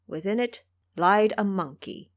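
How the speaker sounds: usual speaking voice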